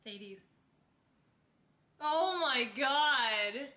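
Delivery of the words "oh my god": shocked. Real, not acted. Shocked by the quote.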